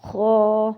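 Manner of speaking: خا said loud, thick and long; heavy kha. Shown as a demonstration. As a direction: thicken خا and hold it. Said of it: "یہ بھی موٹا ہے". (خا موٹا اور کھینچ کر)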